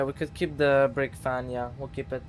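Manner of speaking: usual speaking voice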